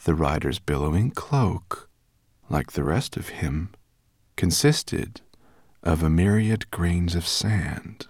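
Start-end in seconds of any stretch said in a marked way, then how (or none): none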